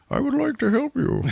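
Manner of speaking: very high, odd put-on villain voice; mock Bane voice. Villain offering help from his cell, very high caricature voice. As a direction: pitch it way up in a silly caricature.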